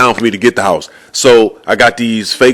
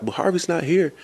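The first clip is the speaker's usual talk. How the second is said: small, soft, higher-pitched timid voice; scared receptionist; 7 semitones higher, 10 dB quieter than usual. Retold or imitated. Young receptionist answering the man yelling at her, scared. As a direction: imitate a cowed young receptionist, barely audible. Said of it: "She all scared 'cause he yelling at her".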